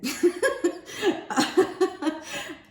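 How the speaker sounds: loud high laughter; high giggle fit. Real, not acted. Reader laughing at herself for calling the viewer bro. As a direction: break into giggles.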